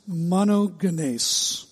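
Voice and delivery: "monogenes" slowly as MAH-no-geh-NACE, hard g; Greek pronunciation. Shown as a demonstration. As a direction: stretch out "monogenes", ending in "-nace". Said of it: "the Greek pronunciation is monogenes".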